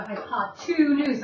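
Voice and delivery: "hypotenuse" with TWO stretched out as a clue; two stressed inside word. Shown as a demonstration. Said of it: "I'm saying the number two".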